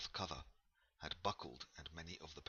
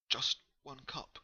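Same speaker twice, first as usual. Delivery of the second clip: pleading offer; 9 semitones higher, 7 dB louder than usual. Acted. Ellen pleads for company.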